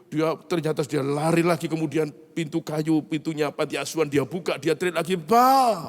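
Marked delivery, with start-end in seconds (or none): 5.1-5.8 high, loud called-out Pak; child shouting. Retold or imitated. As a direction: yell after him like a kid. Son shouts after father again.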